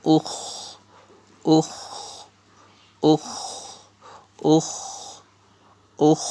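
"ukh" repeated singly, with pauses; drilled "ukh". Shown as a demonstration. Teacher shows alif pesh kha jazm, drilling "ukh". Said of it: "উচ্চারণ".

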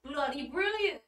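a barely-there fake British accent, just loud; bad British accent. Shown as a demonstration. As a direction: try a British accent and miss. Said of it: "That wasn't good. That just sounded like me being loud".

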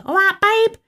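mock an Essex greeting; Essex babe. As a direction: Essex accent, mocking. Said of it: "I hate it when people call each other babe".

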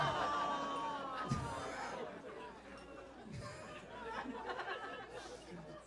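drawn-out high giggle fit; breaking up laughing; long high laugh. Real, not acted. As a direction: laugh long at your own joke. Laughs at own joke.

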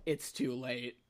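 low, quiet deadpan reply; retold deadpan. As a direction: deliver the punchline low and soft.

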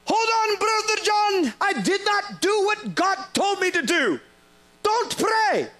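high called-out plea from the crowd; high calling out wait; shouted interruption. Retold or imitated. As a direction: call out to stop the prayer. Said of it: "someone shouted out".

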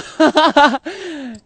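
loud burst of laughter. Real, not acted. Laughs loudly at own joke about being broke.

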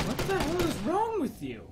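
high exasperated outburst. Real, not acted. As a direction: scold in disbelief. Scolding the guard.

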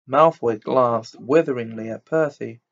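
ordinary delivery, nothing marked

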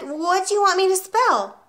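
high-pitched puzzled schoolboy; bewildered child, voice lifted high; little boy. A narrator's reading. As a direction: pipe up in confusion. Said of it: "Eric said".

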